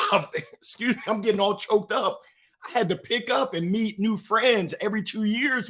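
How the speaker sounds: normal talking voice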